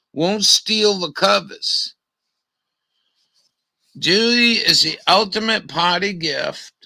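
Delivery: loud, declaimed sales copy; reading an advert aloud. Acted. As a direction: read the product blurb out loud to the room. Reads product advertisement aloud.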